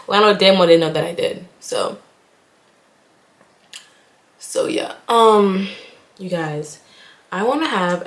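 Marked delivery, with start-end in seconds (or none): none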